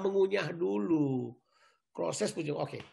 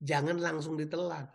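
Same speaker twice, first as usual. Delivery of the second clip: ordinary delivery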